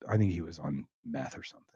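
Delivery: soft unvoiced whisper. Real, not acted. Confiding to the cohost about the man, whispered. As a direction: whisper it like a secret.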